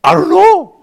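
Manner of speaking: put-on young athlete caught out, high and loud; clueless answer. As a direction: squeak out a shrug of an answer. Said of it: "what I told her is".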